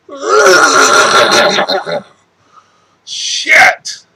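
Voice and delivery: loud, breathy groan and curse; frustrated outburst. Real, not acted. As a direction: spit out the frustration.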